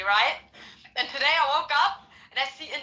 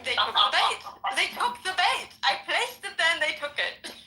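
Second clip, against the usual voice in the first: gleeful laughing; 8 semitones higher than usual. Retold or imitated. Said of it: "And I was like".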